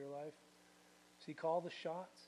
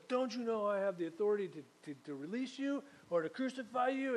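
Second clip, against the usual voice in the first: much higher, fast, a mocking tyrant voice; boastful Pilate; 5 semitones higher than usual. Pilate taunting Jesus, high and fast. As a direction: rattle it off like a puffed-up official.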